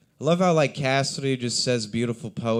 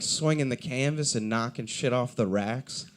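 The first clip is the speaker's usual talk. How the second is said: ordinary talk, nothing marked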